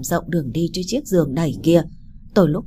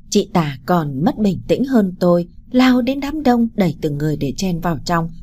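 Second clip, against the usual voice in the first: ordinary delivery, nothing marked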